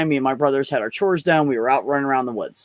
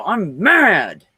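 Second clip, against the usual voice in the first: put-on a little higher and louder, like a pouting five-year-old; sulky kid's "I'm mad"; 6 semitones higher, 4 dB louder than usual. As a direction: announce it like a grumpy small child. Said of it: "like that".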